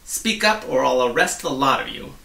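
loud, gruff threat; threatening. Acted. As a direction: threaten the whole crowd. Policeman threatens crowd.

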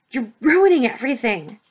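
loud, high, frustrated outburst; furious shout. A narrator's reading. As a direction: shout it as your plan falls apart. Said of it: "Alexander shouted".

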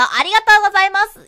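high, loud and quick; bubbly maid thanking her master; maid cafe voice. Pretend. Maid-themed shop cashier.

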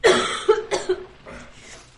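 harsh dry cough; sudden coughing fit. Real, not acted. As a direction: cough hard from the dust. Coughing in dusty place.

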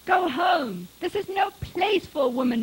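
loud, high, urgent command; shouted order. A narrator's reading. Husband ordering his wife off the mountain, shouting. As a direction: send her away with a shout.

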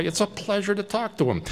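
plain reading voice

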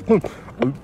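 ordinary talk

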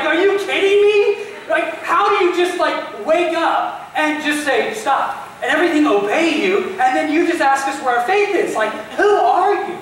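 high, loud, disbelieving protest; acted incredulity. Preacher as stunned disciples questioning Jesus, loudly incredulous.

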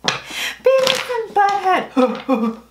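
a failed stab at the cartoon duo, drawn out and high; Beavis and Butthead voice. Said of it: "I can't even do the Beavis and Butthead anymore".

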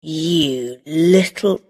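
slow, mild angry growl; a touch of anger, slowly. Acted. Drunk man turning on Rosa, mildly angry. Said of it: "he said angrily".

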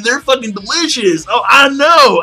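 excited shout. Real, not acted. Excited outburst about candy bars.

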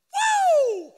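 high, loud whoop; hammed-up woo shout; whoo yell. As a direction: let out a wild whoop. Recalling emotional teenage years.

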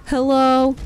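"Hello" belted out and held level; demonstrated loud hello. Shown as a demonstration. Streamer showing how to say hello, very loud. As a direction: blast the hello at them. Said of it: "you say it like this".

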